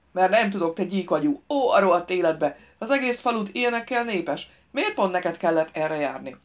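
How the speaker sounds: loud, exasperated outburst; irritable driver, cursing. Acted. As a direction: curse your rotten luck at the idiot.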